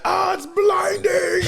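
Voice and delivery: high, slow, drawn-out wail; mock agony cry. Blood thrown in his face, hammed-up high wail. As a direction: wail it out as if blinded. Character struck in eye with blood.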